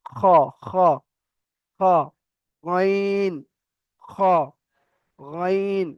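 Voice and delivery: খ rasped from the end of the throat, গইন voiced and held; throat letter demo. Shown as a demonstration. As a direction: scrape খ and গইন from the back of the throat. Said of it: "এমন ভাবে উচ্চারণ হবে". (কণ্ঠনালীর শেষ থেকে খ ও গইন উচ্চারণ)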